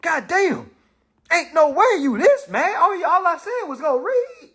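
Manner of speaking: stunned at the hate; indignant disbelief. Retold or imitated. Shocked reply to a jealous hater online. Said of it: "I'm like".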